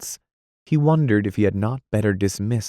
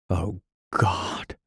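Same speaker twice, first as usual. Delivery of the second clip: softer, despairing sigh of words; hopeless; 4 dB quieter than usual. Acted. Anthony giving up at Dot's bedside, soft hopeless sigh. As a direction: let it sink out of him. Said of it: "said Anthony hopelessly".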